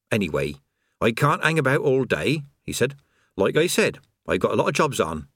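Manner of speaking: natter on as if all is fine; chatty creator. Acted. Creator chatting idly beside a falling man.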